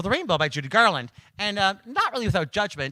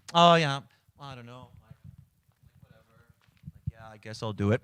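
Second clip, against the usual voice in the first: 1 mock reluctance; 4 semitones lower than usual. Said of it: "But they're like this".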